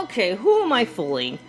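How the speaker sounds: ordinary delivery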